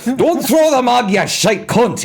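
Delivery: high, slow put-on Scottish accent; hammed-up Scottish Trainspotting line; mock Scottish film accent. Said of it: "in the accents from Trainspotting".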